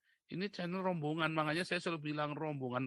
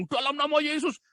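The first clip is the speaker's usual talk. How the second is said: high, loud commanding cry; mock exorcism command; 11 semitones higher, 9 dB louder than usual. Mimicking a pastor rebuking looting, shouted mock command. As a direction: imitate a preacher casting something out.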